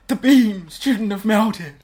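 loud, slow frantic Nick Cage; Nick Cage impression. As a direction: rant it slowly like a wild-eyed conspiracy nut. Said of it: "Nick Cage running around going".

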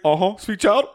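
ordinary talk

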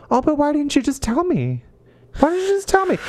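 high, pleading whine, rising on the repeat; whiny plea. Acted. Tatwine pleading with Lufgar in a high whine. Tatwine voiced as whining lover.

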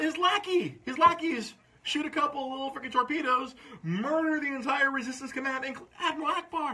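high-pitched incredulous outrage; indignant rant. Real, not acted. Fan ranting about how Admiral Ackbar died, indignant. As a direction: rail at the injustice, voice pitched high.

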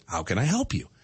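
low, even automated prompt; car voice assistant. Retold or imitated. Car assistant offers dad help, lightly imitated low voice. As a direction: lightly imitate a polite phone system. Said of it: "she's like".

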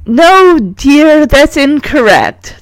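high opening, slowing to let her down; correcting teacher. A narrator's reading. Teacher informs Margot her answer is wrong, correcting. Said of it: "the teacher informs her".

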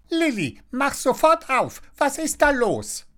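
very high, insistent busybody; high squawky neighbour giving orders; Frau Schnattermann chattering. A narrator's reading. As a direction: demand it shrilly through the door. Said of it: "schnattert Frau Schnattermann".

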